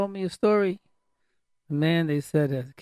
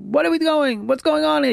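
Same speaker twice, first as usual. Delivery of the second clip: high, loud angry outburst; angry yell; 9 semitones higher, 4 dB louder than usual. Shown as a demonstration. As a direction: snap at the family in a raised voice. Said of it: "Same tone of voice".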